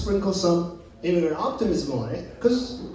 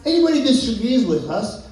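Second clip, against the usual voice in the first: ordinary delivery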